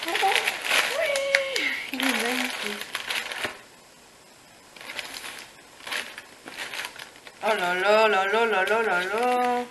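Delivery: slow oh là là of pure delight; gushing over a new agenda; swooning. Real, not acted.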